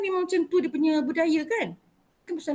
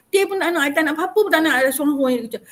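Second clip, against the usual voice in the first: usual speaking voice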